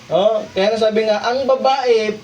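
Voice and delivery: ordinary talk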